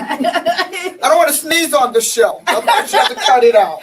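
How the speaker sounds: laughing loudly through the words. Real, not acted. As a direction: blurt it out laughing. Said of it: "I'm trying not to sneeze".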